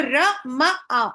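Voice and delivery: र म आ spaced out slowly, each syllable on its own. Shown as a demonstration. Teacher repeating the syllables for her class, slow and separated. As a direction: slowly sound out र म आ. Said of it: "इसको हम syllable बोलते हैं".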